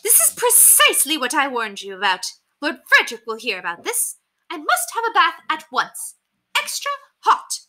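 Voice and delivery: high-pitched, indignant lady in horror; horrified Lady Constance. A narrator's reading. Lady Constance backs away from the soggy bird, horrified. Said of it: "backed herself to the door in horror".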